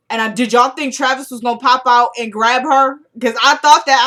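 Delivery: loud, animated burst to the listeners; excited. Real, not acted. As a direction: ask the audience with loud excitement. Excited reaction to feared ambush.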